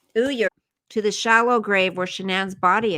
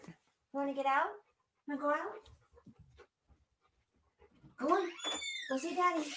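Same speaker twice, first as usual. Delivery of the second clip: much softer, higher murmur to the dog; soft pet talk; 6 semitones higher, 16 dB quieter, much faster than usual. Real, not acted. Streamer letting the dog out, soft high pet talk. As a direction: send the dog off gently.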